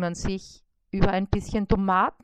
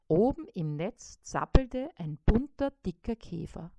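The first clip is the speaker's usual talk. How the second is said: ordinary delivery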